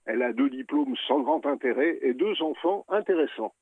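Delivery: ordinary talk, nothing marked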